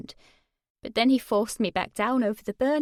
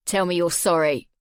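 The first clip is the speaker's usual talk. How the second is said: low, cold, lower and steady; menacing; 4 semitones lower, 6 dB louder than usual. Acted. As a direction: grind out each demand. Jay demanding again.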